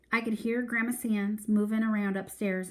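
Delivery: ordinary delivery, nothing marked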